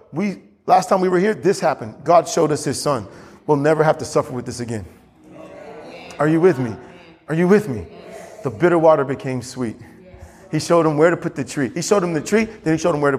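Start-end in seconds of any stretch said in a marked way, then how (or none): none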